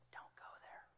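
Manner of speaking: unvoiced, barely audible warning; whispered gentle warning. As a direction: breathe the warning like a secret. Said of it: "and he whispers".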